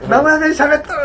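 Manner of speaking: high-pitched teasing jeer; retold jeering. Co-workers mock a colleague's dialect slip, high teasing call. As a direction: mimic office mates gleefully calling him out. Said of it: "はやしてたから".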